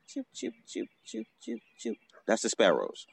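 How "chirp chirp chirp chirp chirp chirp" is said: high, soft quick bird chirps; sparrow chirps. Shown as a demonstration. Showing a friend what the sparrows sound like, high soft chirping. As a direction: chirp lightly like little sparrows. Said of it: "You hear the sparrows".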